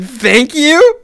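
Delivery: high, loud, questioning thank-you; bewildered thanks. Retold or imitated. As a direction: imitate someone thanking a total stranger, baffled. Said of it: "she does not know who I am".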